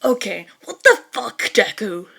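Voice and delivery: loud disbelieving outburst; shocked shout. Acted. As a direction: blurt it out in shock. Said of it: "a shout alerts her to it".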